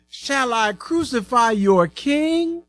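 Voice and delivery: high, slow, drawn-out question; challenging Pilate. A narrator's reading. Pilate asks the shouting crowd about their king, challenging. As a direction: stretch the question out, pitched well up.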